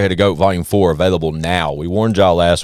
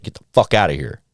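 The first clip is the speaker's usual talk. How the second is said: ordinary talk, nothing marked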